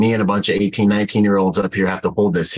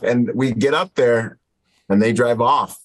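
usual speaking voice

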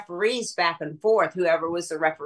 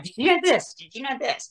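high, excited gossip voice; gossipy excitement; 7 semitones higher than usual. Retold or imitated. To Paulie on late-night calls, a high gossipy whisper-thrill. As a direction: mimic the breathless phone gossip.

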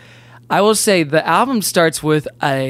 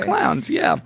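silly clown; 14 semitones higher, 4 dB quieter than usual. Pretend. Clown joke ends high.

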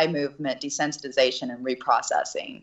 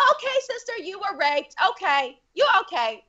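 much higher, gentle little-girl voice imitating the Cambodian girls; little girls comforting; 14 semitones higher than usual. Cambodian girls calmly reassure their teacher, high little-girl voice. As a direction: lift way up into a small girl's soothing voice. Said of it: "And they're like".

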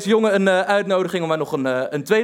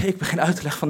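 ordinary delivery

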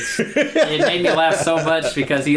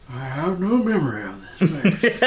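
Gandalf; 4 semitones higher than usual. Retold or imitated. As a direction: playfully imitate a puzzled old wizard. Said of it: "Gandalf gets to the pass and he goes".

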